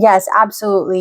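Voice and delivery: ordinary talk, nothing marked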